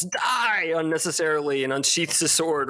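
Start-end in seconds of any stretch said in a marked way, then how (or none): 0.0-0.7 play-acted cry of attack; mock battle yell. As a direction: imitate a noble's yelled outburst. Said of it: "One of the nobles yells".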